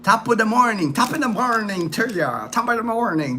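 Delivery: put-on playful Irish accent reading a viewer's greeting; mock Irish accent. Host voicing a chat greeting in a mock Irish accent. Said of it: "That's like Irish... accent there".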